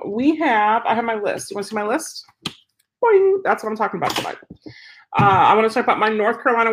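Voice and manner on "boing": playful boing. Pretend. Showing list to camera.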